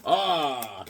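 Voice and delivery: ordinary talk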